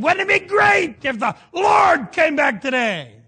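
high, slow, booming call to the crowd; excited shout. Retold or imitated. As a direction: shout it out like a revival cry. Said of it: "and I said".

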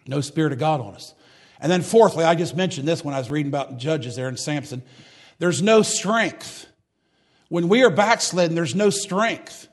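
ordinary talk, nothing marked

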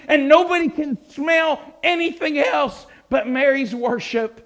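high, loud, rising preacher's cry; preaching at full force. Acted. Preacher describing the perfume filling the room, shouting with fervor. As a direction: build it to a shout over the congregation.

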